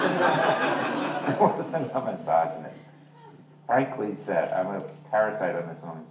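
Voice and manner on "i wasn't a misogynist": laughing. Real, not acted. Joking denial after laughing.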